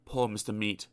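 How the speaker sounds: ordinary delivery, nothing marked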